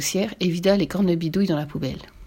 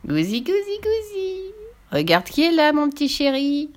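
high sing-song witch cooing; teasing witch; 8 semitones higher than usual. A narrator's reading. Witch greeting the boy in the kitchen, mock-sweet. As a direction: coo mockingly, climbing very high.

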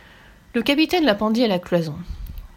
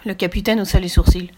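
usual speaking voice

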